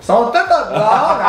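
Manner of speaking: high, loud laughing outburst; amused exclamation. Real, not acted. Host teasing the guests about blondes, high amused outburst.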